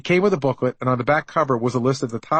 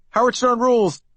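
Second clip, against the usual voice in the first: screaming prank caller; 10 semitones higher than usual. Retold or imitated. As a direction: scream it down the phone line.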